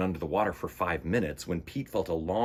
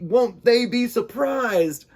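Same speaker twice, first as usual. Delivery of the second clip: high, loud eager question; bursting with excitement; excited; 12 semitones higher, 9 dB louder, slower than usual. Acted. Pete excited about home.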